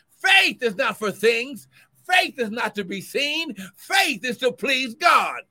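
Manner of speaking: loud, rising sermon cadence; fervent preacher building; preaching at full force. Acted. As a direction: drive the point home to the church. Preaching declaration.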